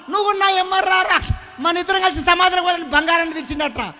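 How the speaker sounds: loud, high desperate cry of a dying father; father pleading with gold. Acted. Dying father calls his gold son: high pleading shout. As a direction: shout it as a father begging not to die alone.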